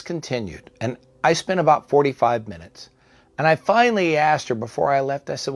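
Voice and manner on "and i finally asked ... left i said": ordinary delivery, nothing marked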